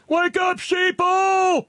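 very high, loud hammed-up holler; full-throated, high-pitched mock alarm; mock rallying shout. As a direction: shout it like a mock crank's battle cry. Reads listener's rallying cry aloud.

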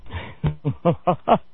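real chuckle breaking out before the story; amused chuckle. Real, not acted. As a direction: laugh a little, helplessly, at an odd story.

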